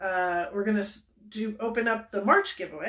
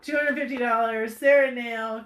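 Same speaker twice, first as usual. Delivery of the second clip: usual speaking voice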